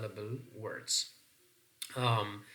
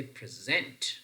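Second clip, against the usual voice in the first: "present" stressed on the second syllable, pre-SENT. Shown as a demonstration. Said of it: "stress pattern is on the second syllable".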